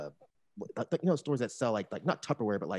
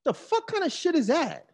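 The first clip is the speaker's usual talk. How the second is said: high-pitched, incredulous challenge; indignant, much higher pitch; retold indignation. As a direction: replay an outraged confrontation, voice jumping up. Retelling own angry confrontation.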